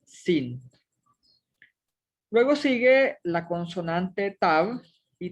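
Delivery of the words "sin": "sin" said low with a plain s; final reading. Shown as a demonstration. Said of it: "pues que suena como N".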